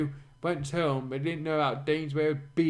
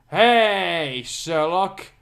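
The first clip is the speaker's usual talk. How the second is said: louder call across the room; 2 semitones higher, 5 dB louder, much slower than usual. Acted. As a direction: shout his name to get his attention. Character calls across room.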